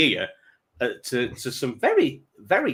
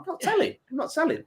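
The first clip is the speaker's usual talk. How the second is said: high, sing-song teasing; high playful I'm not telling; 3 dB quieter, faster than usual. Retold or imitated. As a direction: taunt it in a high voice. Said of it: "I'm like".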